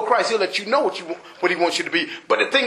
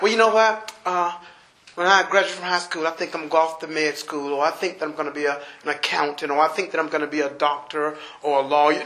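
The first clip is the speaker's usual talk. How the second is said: much lower and softer, rattled off fast; lower, softer, fast mock of a graduate's plans; mocking teenager voice; 9 semitones lower, 6 dB quieter, faster than usual. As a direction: mimic a self-sure student listing plans, low and quick. Imagined young person's career plans.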